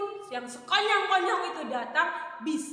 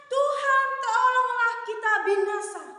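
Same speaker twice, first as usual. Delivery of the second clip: panicked cry for help; 9 semitones higher, 3 dB louder than usual. Acted. Disciples crying out in storm.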